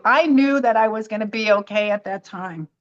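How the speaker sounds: usual speaking voice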